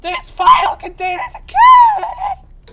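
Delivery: very high, loud laughing shriek; laughing excited shout. Real, not acted.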